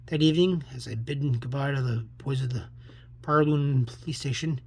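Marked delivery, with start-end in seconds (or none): none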